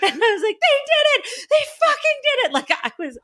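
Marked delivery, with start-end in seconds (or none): 0.6-2.5 high, teary laughing triumph; half laughing, half crying with joy; laughing cheer. Retold or imitated. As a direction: fan reliving the ending, tearful laughing triumph. Cheering the pilots' success.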